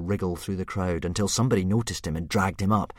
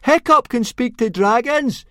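slow, high, very loud shout; 11 dB louder, slower than usual. A narrator's reading. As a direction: announce it to the whole crowd. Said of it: "shouted Fishlegs very loudly indeed".

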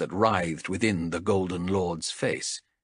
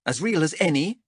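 snapped; 7 semitones higher, faster than usual. Acted. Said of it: "snapped Chev".

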